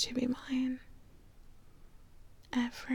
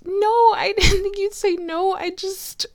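high, loud, tearful protest; happy crying; 8 semitones higher, 7 dB louder than usual. Acted. Explaining proposal nerves to the new fiance, happy crying. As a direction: defend yourself through tears and laughter. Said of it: "Sorry, I'm crying".

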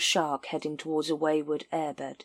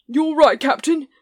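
loud, high eager agreement; a hint of a keen sergeant; 10 semitones higher, 8 dB louder than usual. A narrator's reading. Colon voiced.

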